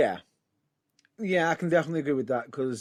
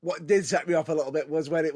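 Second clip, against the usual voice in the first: ordinary delivery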